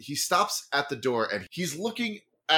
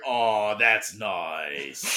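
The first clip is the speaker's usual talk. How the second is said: slow mock aww; 5 semitones lower, much slower than usual. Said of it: "From the bar, you hear".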